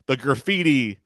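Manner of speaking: normal talking voice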